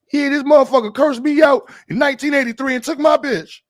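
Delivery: put-on high, loud old-head grievance; mock old-head accent. Old head griping about a decades-old insult, high loud mock rant. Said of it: "cursing you out with an accent from nineteen eighty-five".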